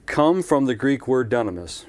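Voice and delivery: ordinary delivery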